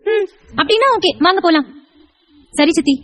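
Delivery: put-on high small-child voice; agreeable child. Child agrees to go along with aunty, high trusting child voice. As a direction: give in like a trusting little kid.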